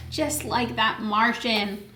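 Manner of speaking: ordinary delivery, nothing marked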